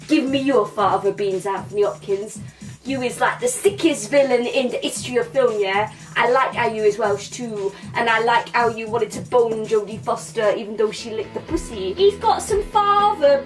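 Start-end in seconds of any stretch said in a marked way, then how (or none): none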